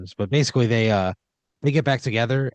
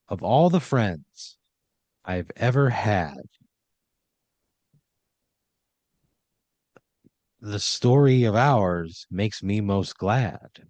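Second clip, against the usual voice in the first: slow, earnest greeting-card recital; sappy poem reading; slower than usual. Acted. Game master slowly reciting Tanya's card poem to Pat. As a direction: read it like a heartfelt card verse, slowly.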